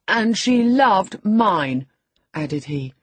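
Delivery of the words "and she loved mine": put-on loud, slow, higher boyish gloat; gloating taunt. A narrator's reading. Boy goading his enraged cousin, loud taunt. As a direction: rub it in, drawn out loud.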